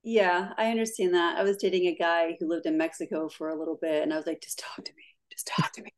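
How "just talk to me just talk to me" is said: whispered, playful begging; breathy mock plea. Woman begging her boyfriend to talk, mock-breathy whisper.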